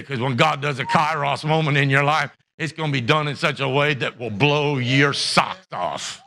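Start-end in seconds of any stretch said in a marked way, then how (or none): none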